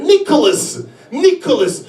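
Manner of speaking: put-on high little-girl voice saying a name; acted young child answering papa; little daughter. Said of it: "Elle me fait".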